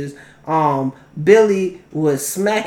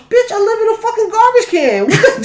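mock grouch retort; 13 semitones higher than usual. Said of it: "They're like".

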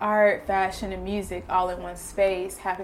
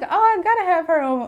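excited; 10 semitones higher, 7 dB louder than usual. Retold or imitated. Retold eager thought.

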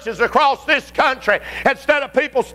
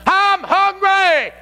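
whining hungry flesh; 7 semitones higher than usual. Acted. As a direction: whine like a starving child. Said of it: "The fleshly man goes".

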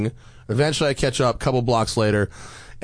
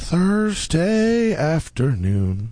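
drawn-out Thursday; 3 semitones higher, much slower than usual. Acted. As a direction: stretch Thursday afternoon slowly. Host greets the show.

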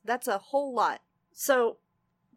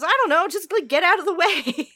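excited urging; 7 semitones higher, 9 dB louder than usual. Retold or imitated. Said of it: "He goes".

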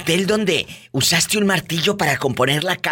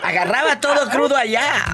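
laughing; 10 semitones higher than usual. Real, not acted. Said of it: "repeats caller's words laughing".

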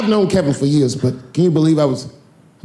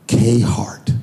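hushed, lower, reverent; whispered name; 5 semitones lower than usual. Retold or imitated. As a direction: breathe the name in awe. Naming stitched letters.

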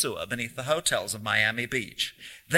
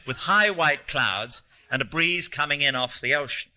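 ordinary delivery, nothing marked

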